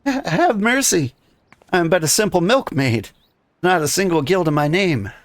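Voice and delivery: high, pleading milkmaid voice; frightened milkmaid. Acted. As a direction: beg for mercy as a scared milkmaid. Player voices frightened milkmaid NPC.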